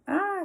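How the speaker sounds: short high scream; a sudden high "Ah!" of pain. A narrator's reading. Leslie yelps as her pigtail is yanked, high scream. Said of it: "screamed Leslie".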